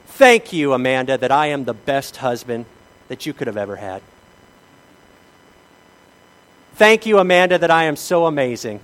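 loud, hammed-up self-praise; mock bragging husband. Pastor hams up bragging to his wife Amanda, loud and smug. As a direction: boast to her like a pompous husband. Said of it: "I walked up to Amanda and I said".

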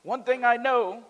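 preacher reading John chapter nine aloud; scripture reading. Acted. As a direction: read the passage to the congregation with conviction. Reads scripture aloud with force.

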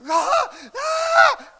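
very high, loud thrilled yell; imitating a fan's wild yell; excited yelp. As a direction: shriek like the cars just blew past. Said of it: "and I'm like".

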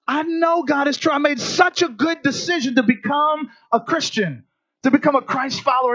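high, bright-eyed joy of a new believer; mock overjoyed convert; elated believer. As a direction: gush like everything's going right. Preacher acting an elated believer.

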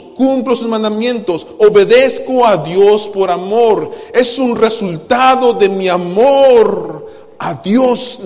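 loud, high, slow preaching that drags each word; full-force preaching. Acted. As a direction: hammer the point home to the church. Preaching on love and obedience. (predicación fuerte, aguda y muy lenta)

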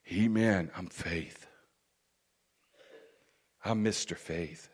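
low, very soft self-introduction; understated Faith character. Acted. Faith answering who he is, in a quiet low voice.

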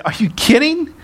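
high-pitched, scornful disbelief; hammed-up haughty indignation; arrogant. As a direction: fire off incredulous questions as if far above them. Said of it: "he could have arrogantly said".

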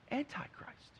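breathy hushed warning on antichrist; hushed emphasis. Real, not acted. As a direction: drop to a whisper for antichrist. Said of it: "preacher stresses key word".

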